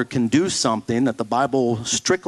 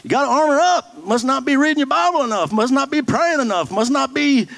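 mock preachy reply; 10 semitones higher than usual. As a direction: chirp it back like a pat answer. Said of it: "My marriage is failing".

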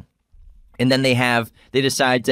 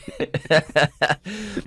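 giggling laugh; 6 semitones higher, 3 dB quieter than usual. Real, not acted. Laughing at own bit.

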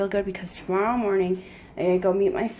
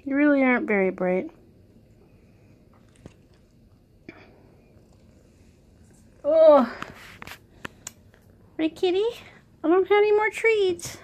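pet talk; 5 semitones higher than usual. Real, not acted. Owner teasing her cat with a treat, high baby talk. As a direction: coo at the kitty in a high voice.

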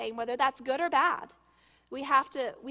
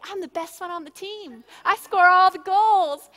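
bragging shout; 6 semitones higher, 13 dB louder than usual. Retold or imitated. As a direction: shout it proudly like a boastful kid. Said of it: "tell everybody".

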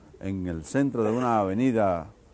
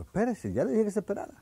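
impatient coordinator; 11 semitones higher than usual. Acted. Coordinator fed up again.

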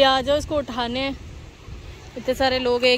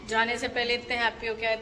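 ordinary talk, nothing marked

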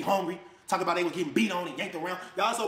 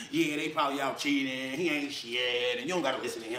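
mocking the mom; 5 semitones lower than usual. Mimicking the ex coaching her daughter against him, low and sneering. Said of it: "she would be coaching her daughter".

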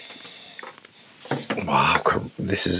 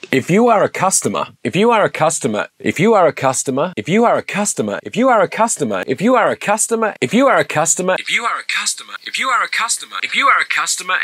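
higher, polished automated menu read; phone menu voice; 7 semitones higher than usual. Shown as a demonstration. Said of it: "I'm about to do these voiceovers".

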